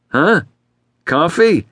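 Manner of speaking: high-pitched, caught-off-guard question; distracted, surprised client; startled. A narrator's reading. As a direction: jump in high, as if jolted out of thought.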